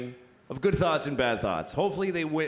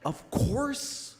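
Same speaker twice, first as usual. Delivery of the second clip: righteous high voice; 5 semitones higher than usual. Shown as a demonstration. Naming the good half of his brain, high and righteous. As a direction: lift it up piously, voicing the good thought. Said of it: "a category in my brain that I call".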